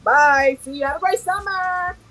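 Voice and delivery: high singsong goodbye, fading away; hurried cheery brush-off. Retold or imitated. Acted farewell dodging the question.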